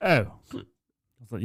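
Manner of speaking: ordinary talk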